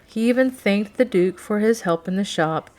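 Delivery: ordinary delivery, nothing marked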